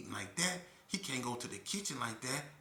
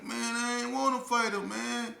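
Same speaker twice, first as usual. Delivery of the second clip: reluctant cellmate; 13 semitones higher, 9 dB louder than usual. Retold or imitated. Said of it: "So he like".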